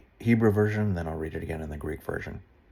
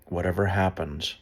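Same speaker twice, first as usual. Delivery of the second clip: measured, earnest Bible reading; a solemn read of Job, lightly heightened; scripture reading; 8 dB louder, slower than usual. Acted. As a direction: read the passage out to listeners.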